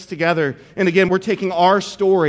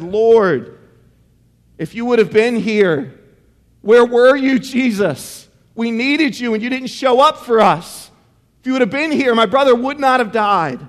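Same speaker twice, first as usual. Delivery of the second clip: higher, forceful pleading; anguished reproach; 4 semitones higher, 4 dB louder than usual. Acted. Grieving sister confronts Jesus who came too late, anguished reproach. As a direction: plead it with raised pitch, pressing each phrase.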